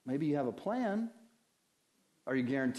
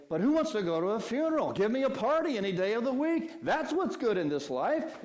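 higher, louder party-lover protest, quick at first; mock objector; 7 semitones higher, 5 dB louder than usual. Preacher voicing a listener who prefers parties, mock protest. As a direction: scoff like someone who'd rather party. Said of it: "But you say".

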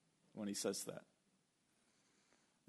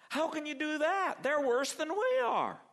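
much higher and louder, incredulous; Habakkuk squeaks out his outrage at God; outraged protest; 6 dB louder than usual. Retold or imitated. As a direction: cry out in disbelief at God.

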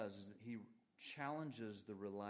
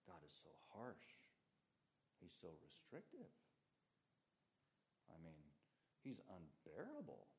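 softer, lower, slipping the doubt in; sly insinuation; 6 semitones lower, 8 dB quieter than usual. Retold or imitated. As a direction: murmur it like a tempter planting suspicion. Voicing Satan's insinuations about God.

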